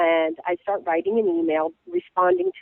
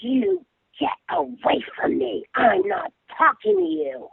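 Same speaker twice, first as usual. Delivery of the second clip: slow, higher, word-by-word warning; mock menacing cop; 6 semitones higher, slower than usual. Cop glaring at the blogger who approached him, mock menace. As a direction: imitate a cop's slow, angry brush-off. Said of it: "Just like that".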